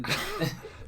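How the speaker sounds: airless giggle; breathy laugh. Real, not acted. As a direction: laugh silently through the nose. Laughing at the joke.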